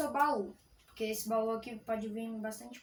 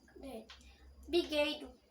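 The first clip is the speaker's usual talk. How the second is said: ordinary delivery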